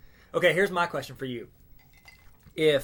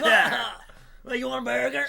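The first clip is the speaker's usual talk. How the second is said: Kel impression; 9 semitones higher, 6 dB louder than usual.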